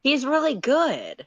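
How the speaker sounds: high, bright praise. Acted. John praising Karkat's singing to Dave, bright. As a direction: say it all lit up. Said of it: "John says brightly".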